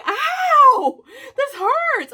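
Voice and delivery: high laughing ouch; mock pained cry. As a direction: yelp in mock pain. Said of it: "I'm like".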